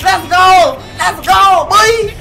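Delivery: loud triumphant shout; hyped shout. Real, not acted. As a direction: cheer the win out loud. Hyped after the kill.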